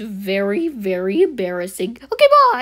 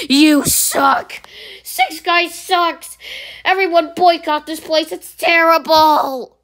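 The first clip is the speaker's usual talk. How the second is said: higher, louder yelling, slow at the end; angry rant; 6 semitones higher, 6 dB louder, slower than usual. Acted. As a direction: shout it for everyone to hear. Customer rants at the place.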